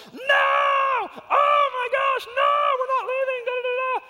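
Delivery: put-on high, loud, slow child's wail; daughter flipping out. Dad imitating his daughter refusing to leave, high shrieking tantrum. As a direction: screech like a toddler refusing to leave. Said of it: "totally flipping out".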